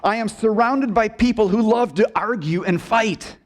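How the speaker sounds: high, emphatic grievance; Habakkuk's complaint. Acted. Preacher voices the prophet's grievance to God, indignant complaint. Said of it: "because he says this".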